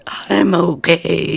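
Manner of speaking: slow, inhaled creepy words; E.T. kid voice. Shown as a demonstration. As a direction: talk while breathing in, slowly. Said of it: "Do it like the kid in E T. You have to talk when you're breathing in".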